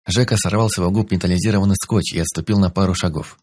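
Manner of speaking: usual speaking voice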